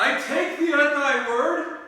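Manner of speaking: loud, high, slow proclamation; bold Romeo. Acted. Romeo boldly reveals himself to Juliet, loud bold declaration. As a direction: call it out bravely from the dark. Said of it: "He finally gets brave and he steps out".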